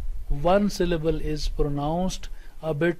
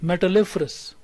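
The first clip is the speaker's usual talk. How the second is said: "Metalliferous" with main stress on "lif", lighter on "Met"; primary and secondary stress; 2 semitones higher, 4 dB louder than usual. Shown as a demonstration. Teacher shows both stresses to students, strongest on the third syllable. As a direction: model "Metalliferous", punching the "lif". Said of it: "this is primary stress".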